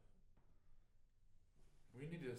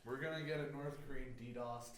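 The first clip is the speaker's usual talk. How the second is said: laughing through the words; 4 semitones higher, 5 dB louder than usual. Real, not acted. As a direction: laugh while saying it.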